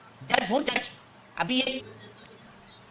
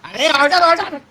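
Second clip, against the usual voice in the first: loud; 2 semitones higher, 6 dB louder than usual. Acted. Judge calling court to order.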